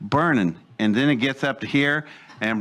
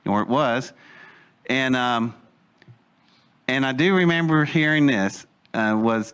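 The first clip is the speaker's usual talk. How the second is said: normal talking voice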